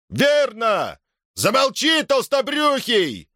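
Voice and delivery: high, rude shout from a crowd; heckling yell. A narrator's reading. Villagers in the crowd shout down the fat speaker, heckling.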